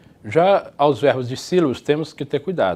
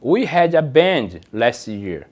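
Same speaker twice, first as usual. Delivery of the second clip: very slow, careful English; slow model sentence; much slower than usual. Shown as a demonstration. Teacher reads example sentence slowly to class. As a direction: read it slowly for learners. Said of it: "Nós tínhamos uma banda".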